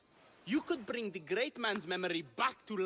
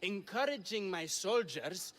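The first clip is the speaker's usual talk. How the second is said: normal talking voice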